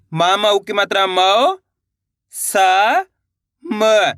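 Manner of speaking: मौ, सा, म sounded out separately, each drawn out; one syllable at a time. Shown as a demonstration. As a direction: sound out मौ... सा... म slowly with pauses between. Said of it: "एक अक्षर का उच्चारण करना है". (मौ, सा, म एक-एक अक्षर खींचकर अलग-अलग बोलें)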